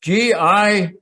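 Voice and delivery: slow, separated letters for a listener; spelling out. Real, not acted.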